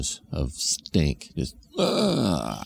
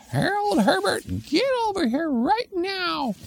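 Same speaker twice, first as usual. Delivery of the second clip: high shrill calling-out mom; mom calling kids. Retold or imitated. Mother calling her running kids back, high yell.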